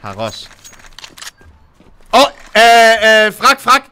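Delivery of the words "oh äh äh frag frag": grenade warning yell. Real, not acted. Grenade thrown during firefight.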